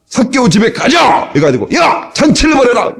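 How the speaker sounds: high, ringing shout to the crowd; hearty call out. Acted. Jesus shouts to disciples to throw a feast, hearty. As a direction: call out like a jolly host.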